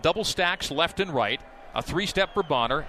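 ordinary talk

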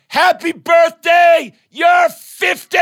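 slow, high birthday yell; shouting. Retold or imitated. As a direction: yell the surprise together. Class shouting surprise.

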